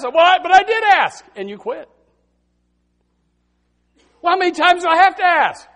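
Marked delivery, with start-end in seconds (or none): 0.0-1.2 high, loud objection; mock protest. Believer objects to being told to ask, mock loud protest. 4.2-5.7 high, loud, fast complaint; mock exasperation. Believer complains about asking repeatedly, mock exasperated protest.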